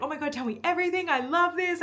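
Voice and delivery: high, fast excited gush; squealing. Retold or imitated. Pen hears the news and squeals, high and fast. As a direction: gush like a thrilled best friend. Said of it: "starts, like, squealing".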